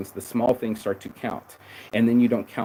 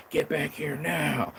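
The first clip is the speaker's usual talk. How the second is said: lighthearted call; 3 semitones higher, 5 dB quieter, slower than usual. Retold or imitated. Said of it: "just being lighthearted".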